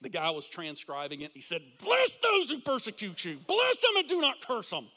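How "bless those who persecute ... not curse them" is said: emphatic Paul. Retold or imitated. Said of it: "That's how he said it".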